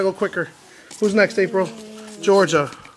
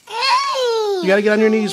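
laughing; 14 semitones higher than usual. Real, not acted. Laughs while coaching kid.

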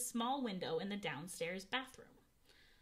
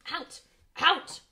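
high frantic yell, building; shouting; 7 semitones higher, 10 dB louder than usual. A narrator's reading. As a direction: bellow them out of the room. Letters pelting, Vernon yells.

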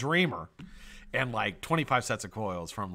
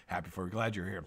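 normal talking voice

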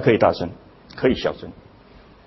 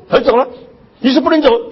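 indignant complaint; 12 semitones higher, 3 dB louder than usual. Acted. Continues acting the scene.

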